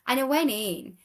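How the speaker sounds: usual speaking voice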